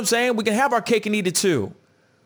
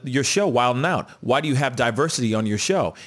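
usual speaking voice

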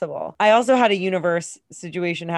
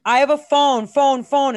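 calling out from a distance, louder and higher; mock shout after someone; retold yell; 6 semitones higher, 6 dB louder than usual. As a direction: friend yelling across the lot. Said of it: "his friend is yelling".

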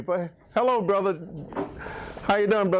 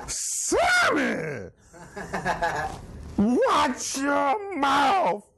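scolding shout; 8 semitones higher, much slower than usual. Retold or imitated. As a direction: yell it like a wife catching you cussing. Wife scolding him over phone.

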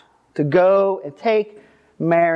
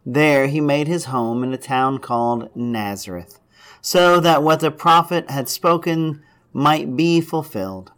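solemn, measured Gospel reading; scripture reading; 5 semitones lower than usual. Acted. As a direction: read the scripture to the congregation. Reads the Gospel aloud.

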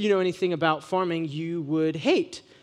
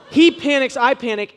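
sudden jump up, louder and higher; excited; 7 semitones higher, 9 dB louder than usual. Real, not acted. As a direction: blurt it out in alarm. Telling the panic.

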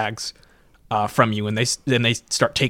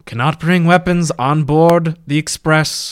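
loud, slow, higher official voice; stern ticket man; 4 semitones higher, 5 dB louder, slower than usual. Acted. Ticket man finds a knife in the bag, stern announcement. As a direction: lay down the rule with authority.

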